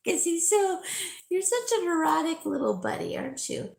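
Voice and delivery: high, quick teasing pet voice, fading to a whisper. Real, not acted. To her neurotic little dog, high teasing baby talk. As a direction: tease the little dog fondly.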